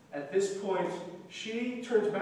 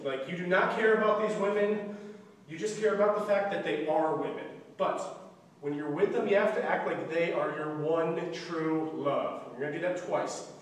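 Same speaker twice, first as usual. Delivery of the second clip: ordinary talk, nothing marked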